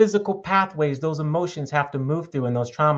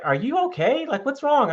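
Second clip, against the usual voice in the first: high, concerned wife voice; mock worried wife; 11 semitones higher than usual. Husband mimicking his wife asking what's wrong, high and worried. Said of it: "and she's like".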